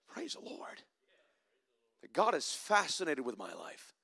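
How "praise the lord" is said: breathy unvoiced whisper of praise. Real, not acted. Preacher alone with his awe, whispered praise. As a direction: barely breathe the words in awe. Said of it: "whispered praise after the climax".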